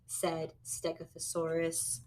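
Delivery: usual speaking voice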